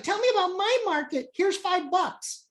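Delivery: high-pitched eager customer parody; mocking gullible followers. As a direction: mimic them in a very high voice. Mocking credulous followers of the creator.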